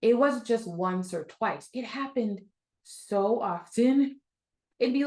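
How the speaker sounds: usual speaking voice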